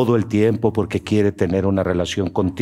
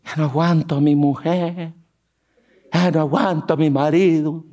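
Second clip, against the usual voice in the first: higher, complaining husband and wife; whiny spouse; 5 semitones higher than usual. Retold or imitated. Preacher mimics a fed-up spouse, higher and whiny.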